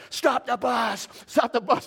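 mock yell at the driver to halt. Boy with a bat yelling at the bus driver, urgent. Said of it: "yelling at the bus driver".

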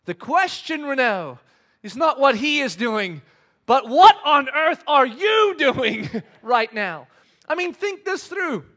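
loud, high self-rebuke; acted indignant scolding; shouting inner voice. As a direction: tell yourself off at full volume. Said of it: "my brain starts shouting at me".

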